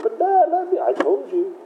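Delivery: put-on high pleading kid's voice, cut off; a boy begging his dad, high and desperate; pleading son. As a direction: beg dad to open the door. Son locked out pleading with father.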